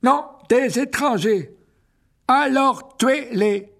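high, loud, slow irritated creature voice; annoyed spider. Acted. Aragog orders the strangers killed, annoyed and loud. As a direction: snap the order like an irritated old monster. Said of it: "cliqueta Aragog d'un ton agacé".

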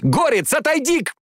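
high-pitched battle cry; urgent high shout to a comrade; urgent call. Acted.

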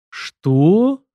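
loud, high, stunned question; taken aback. A narrator's reading. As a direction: blurt it out as if you can't believe your ears. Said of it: "опешил тот и оглянулся". (опешив, громко и изумлённо)

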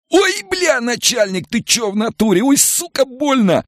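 extremely high squealing in pain; high-pitched whining agony; pained moaning. A narrator's reading. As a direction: moan and squeal at the blow. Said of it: "застонал насильник".

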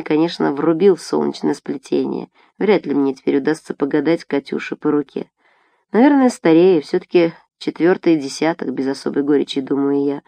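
ordinary delivery, nothing marked

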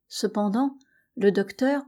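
ordinary delivery

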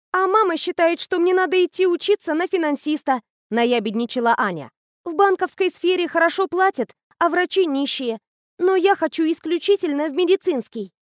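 put-on high, bright-eyed girl raving about a machine; eager teenage girl. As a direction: gush like a know-it-all teen. Anya voiced high.

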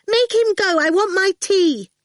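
put-on high, loud child demand; a spoilt girl wanting her tea; demanding brat. A narrator's reading.

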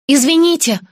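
loud apology. Acted. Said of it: "громко ответила я".

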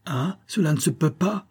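put-on a hurt boy protesting, high and trembling. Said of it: "de sa voix tremblante et aiguë".

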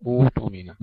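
أوتمنة said with a clear u and no hamza; hamza turned to waw. Shown as a demonstration. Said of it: "وتصبح أوتمنة". (أوتمنة بإبدال الهمزة واوا)

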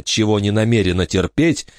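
ordinary delivery

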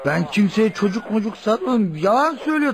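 high, protesting and defensive; a suspect's high-pitched protest; indignant denial. Acted. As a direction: push the accusation away, rattled. Accused man denies angrily.

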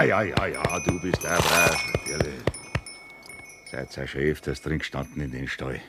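ordinary delivery

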